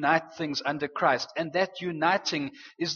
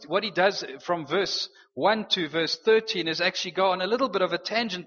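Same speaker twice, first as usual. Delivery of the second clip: normal talking voice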